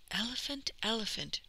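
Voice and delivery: plain reading voice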